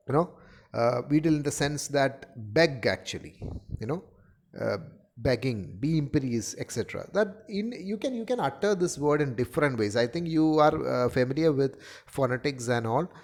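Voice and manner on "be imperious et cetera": usual speaking voice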